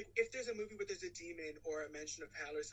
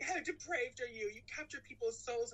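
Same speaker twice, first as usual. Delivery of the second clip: hammed-up indignant accusation, higher pitched; mock outrage; 6 semitones higher, 3 dB louder than usual. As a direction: imitate a righteous scolding. Acted quote of outraged character.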